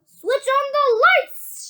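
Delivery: high, slow, loud command; shouted order. A narrator's reading. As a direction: call it out grandly. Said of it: "shouted Mister Wonka".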